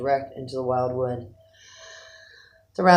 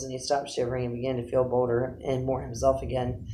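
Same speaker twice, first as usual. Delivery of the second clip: plain reading voice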